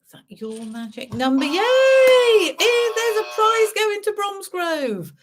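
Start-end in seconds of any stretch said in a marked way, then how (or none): 1.4-5.1 high, loud excited cheer. Real, not acted. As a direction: celebrate the winner. Winner announced.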